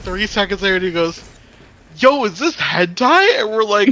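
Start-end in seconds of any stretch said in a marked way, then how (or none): 2.0-3.4 higher, slow dawning realization; imitating a shocked friend. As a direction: drag it out as the horror sinks in. Retold friend discovering the anime.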